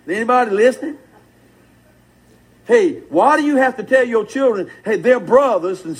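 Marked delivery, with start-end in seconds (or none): none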